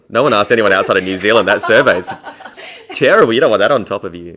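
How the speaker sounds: loud, laughing mock protest; mock indignant outburst. Comedian recounting her objection to an accent survey, mock indignant. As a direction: protest the result at full volume, half joking.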